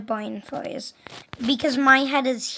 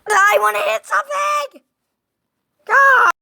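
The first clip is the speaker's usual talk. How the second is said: loud, much higher rage yell building to a scream; furious shout; 11 semitones higher, 11 dB louder than usual. Real, not acted. Gamer rages at the game, furious shout. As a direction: explode at the screen.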